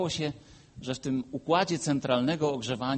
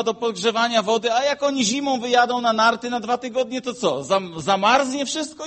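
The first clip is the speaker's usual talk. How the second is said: loud, higher exasperated protest; exasperated retort; 8 semitones higher, 7 dB louder than usual. Retold or imitated. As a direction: push back hard at the mechanic. Retold argument with mechanic.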